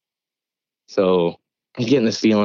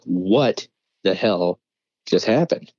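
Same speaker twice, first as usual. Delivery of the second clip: very slow, halting words; dumbfounded, long gaps between words; retold stunned disbelief; slower than usual. Only one not calm about it, slow and stunned.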